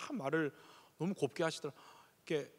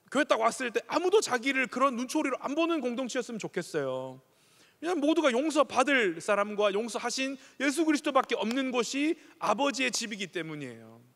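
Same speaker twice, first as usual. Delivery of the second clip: higher, louder pulpit appeal; fervent preaching; 6 semitones higher, 4 dB louder than usual. Acted. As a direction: plead with the congregation from the pulpit. Preaching to the congregation.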